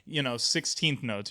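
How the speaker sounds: normal talking voice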